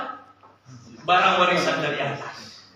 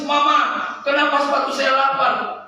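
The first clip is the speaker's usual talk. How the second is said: mock crying child; 6 semitones higher, 6 dB louder than usual. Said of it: "saya nangis".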